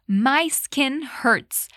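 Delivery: My skin hurts said very slowly for learners; slow model line. Shown as a demonstration. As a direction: spell it out slowly, word by word. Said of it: "O Will disse".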